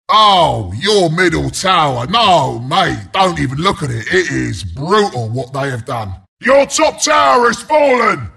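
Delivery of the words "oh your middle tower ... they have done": low, aggrieved groaning sympathy; mock dismay. Announcer warns mate off brutal sight, mock dismayed. Appalled at fallen tower, again.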